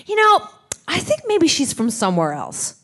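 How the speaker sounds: lightly voiced director, starting high; retold director's suggestion. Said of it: "John phones me up and he says".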